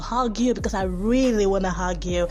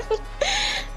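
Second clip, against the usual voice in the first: high short giggle; laugh; 3 dB quieter than usual. Real, not acted. Host introducing herself to foreign listeners, giggling.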